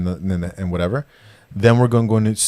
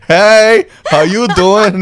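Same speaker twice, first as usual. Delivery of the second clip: loud, higher-pitched pickup line; playful, exaggerated come-on with a laugh; mock flirting; 12 semitones higher, 12 dB louder, much slower than usual. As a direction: ham up hitting on someone. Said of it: "You're not hitting on the imp".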